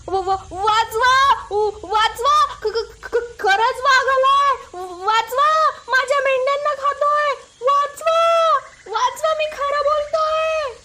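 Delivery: stammering, very high, drawn-out cries for help; panicked shouting. Acted. Boy facing a real tiger, panicked stammering screams. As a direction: scream in terror, stuttering on first sounds. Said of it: "परत ओरडायला सुरुवात केली".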